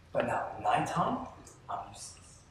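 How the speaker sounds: muttered self-mockery about night; deflated, quiet confession. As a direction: drop to a near-whisper, defeated.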